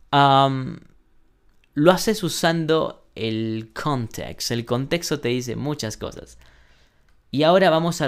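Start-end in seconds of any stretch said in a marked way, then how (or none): none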